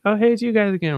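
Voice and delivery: high-pitched perky girl impression; imitated bartender. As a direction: greet them in a put-on high voice. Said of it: "and she's like".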